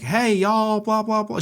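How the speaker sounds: high, twangy Texas country greeting; mock Texas Southern accent. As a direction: imitate a Southern nursery singer. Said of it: "Texas Southern country nursery rhyme".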